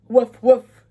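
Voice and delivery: loud, sudden woofs; startled storybook dog. A narrator's reading. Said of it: "said the startled dog".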